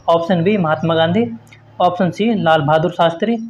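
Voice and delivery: ordinary talk, nothing marked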